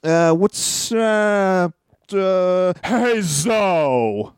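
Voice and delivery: halting mock impression with stretched fillers; Enzo Amore. Host ending the segment with his impression, halting mock voice. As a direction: drag out each hesitation before a drawn-out greeting. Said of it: "with my Enzo Amore impression".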